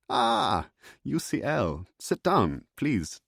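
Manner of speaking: a jolly German doctor inviting him to sit; German accent. Acted.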